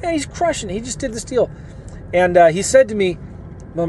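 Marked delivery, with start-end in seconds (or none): 0.0-1.5 high, excited retelling of a friend's praise. Retold or imitated. Friend raving to the speaker about David, high and excited. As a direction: gush it like amazed gossip. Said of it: "my friend told me".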